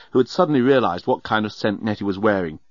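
ordinary delivery

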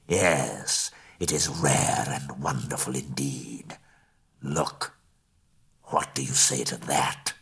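low, slow, half-whispered dragon purr; a vain dragon showing off his belly; preening dragon. A narrator's reading.

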